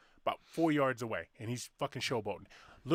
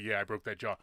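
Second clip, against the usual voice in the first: lower, mumbled Stallone-style growl; Sylvester Stallone; 5 semitones lower than usual. Retold or imitated. Retelling a movie scene, low Stallone impression. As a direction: drop into a slurry Stallone tough-guy voice. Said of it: "Sylvester Stallone's like".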